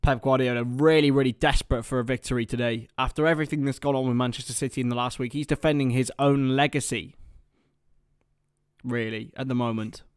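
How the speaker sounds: building match commentary, rising into the kick-off; live play-by-play. Acted.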